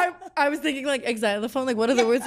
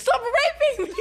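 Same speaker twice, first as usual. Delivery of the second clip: laughing high cry; 11 semitones higher, slower than usual. Acted. Acted victim line in story.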